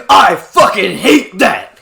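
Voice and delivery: loud, slow, drawn-out furious yell; hateful attacker's shout. Retold or imitated. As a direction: bellow it out as an imagined attacker. Imitating a hateful attacker's outburst.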